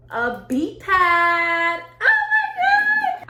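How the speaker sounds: building from sung-out words to a high, loud squeal; excited squeal. Real, not acted. Revealing a new beat pad to viewers, excited squeal. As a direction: stretch it out, then shriek with joy.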